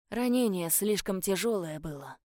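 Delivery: plain reading voice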